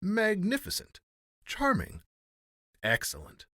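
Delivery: high-pitched admiring cries; exclaimed praise. A narrator's reading. Courtiers echoing praise on all sides, exclaiming. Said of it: "resounded on all sides".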